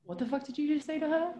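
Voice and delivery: high-pitched mock challenge, held back in volume; retold confrontation. Re-enacting getting in a girl's face, mock challenge. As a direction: square up with rising disbelief. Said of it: "Like that, right?".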